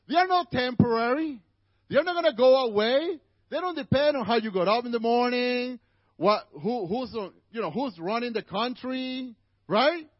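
rising pulpit fervor; fiery preaching. Acted. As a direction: preach it out to the congregation. Preaching at full force.

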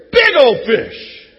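very slow, loud, stretched words; drawn-out fish boast. Shown as a demonstration. Preacher hams up fish story, slow stretched boast. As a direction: drag out every word like a tall tale. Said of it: "It's a fish story".

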